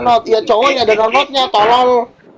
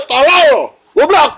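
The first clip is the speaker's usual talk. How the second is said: mocking shout; 6 semitones higher, 3 dB louder than usual. Real, not acted. Heated mocking insult.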